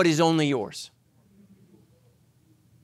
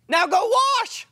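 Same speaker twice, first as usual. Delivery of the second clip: commanding Jesus. Retold or imitated. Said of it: "he said to him".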